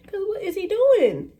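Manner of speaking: can you believe this guy; incredulous. Real, not acted. Storyteller baffled by the gate agent, incredulous high pitch.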